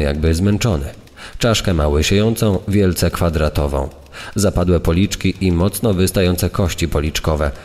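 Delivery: plain reading voice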